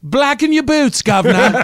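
loud, high mock-Cockney street cry; British accent. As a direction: belt it out in hammed-up Cockney. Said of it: "hates my British accent".

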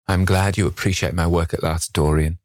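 usual speaking voice

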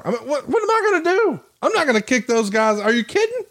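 high-pitched, incredulous protest; exasperated protest. Retold or imitated. Promoter retelling his reply to a backstage complaint, exasperated. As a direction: protest as if the demand is absurd.

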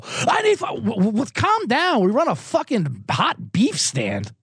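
high frantic chef yelling at staff; mock kitchen screaming. TV chef yelling at his kitchen crew, mock frantic. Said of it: "everyone's screaming at each other on that show".

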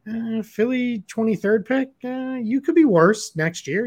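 high, slow put-on voice; high, slow, teasing put-on voice; mock Nets voice. Voicing the Nets' reply.

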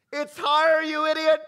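high, loud game show scream; mock yell. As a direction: yell at the contestant. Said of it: "Somebody's always yelling".

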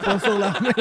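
ordinary talk

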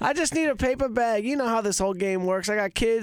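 imitating Nick. Nick pushing Mikey for a paper bag of money, in a high hustler voice. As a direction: play a wheeling-dealing buddy asking for cash. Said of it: "talking to Nick".

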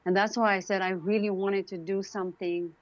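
ordinary delivery

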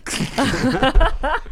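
high burst of laughter; amused laugh. Real, not acted. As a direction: laugh at a silly accent. Amused by the accent demo.

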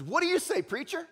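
high-pitched challenge; challenging student. Acted. Student challenges Hauerwas in the crowd, high taunting call. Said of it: "one of the students over here says".

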